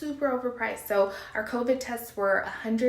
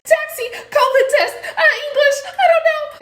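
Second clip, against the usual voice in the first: high, loud, laughing desperate babble; half laugh, half panic, begging for help; hysterical pleading; 10 dB louder than usual. Retold or imitated. Frantic mother begging a taxi driver, high loud laughing panic. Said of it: "I'm almost hysterical".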